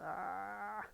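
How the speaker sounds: usual speaking voice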